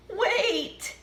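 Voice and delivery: high desperate cry. Acted. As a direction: call after them. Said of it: "cried Sheep".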